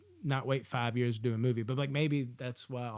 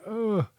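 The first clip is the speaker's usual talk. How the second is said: pained groan; 9 semitones higher, 4 dB louder than usual. Retold or imitated. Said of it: "I was like".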